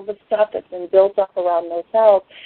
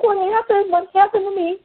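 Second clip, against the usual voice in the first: much higher, breathless amazement; crying out in joyful shock; astonished; 11 semitones higher than usual. Retold or imitated. Asking her mom after the stroke lifts, astonished and high. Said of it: "I'm looking at my mom like".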